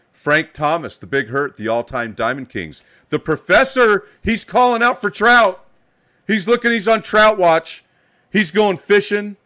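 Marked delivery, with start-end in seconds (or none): 3.1-9.4 higher and louder, hyping the chat; excited hype. Real, not acted. Streamer hyping The Professor's trout hunt, excited and loud. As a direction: pump up the room about a trout hunt.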